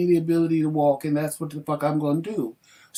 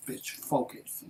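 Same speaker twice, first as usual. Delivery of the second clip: whispered self-command; 4 semitones lower, 13 dB quieter, slower than usual. Retold or imitated. Urging own eye in thought.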